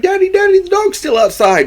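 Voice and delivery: very high, mock-panicked plea to daddy; imitating screaming child. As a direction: squeal it like a frantic kid, without much volume. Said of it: "like, screaming for the dog".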